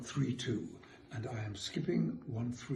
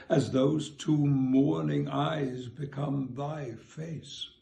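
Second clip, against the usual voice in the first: measured poetry reading, louder; reciting a sonnet; 2 semitones higher, 6 dB louder, slower than usual. Acted. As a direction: read the sonnet with care. Reads sonnet aloud.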